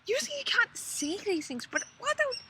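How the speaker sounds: asking in awe; amazed disbelief. Retold or imitated. Staring at angels in the desert sky, amazed. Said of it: "we keep on rubbing our eyes going".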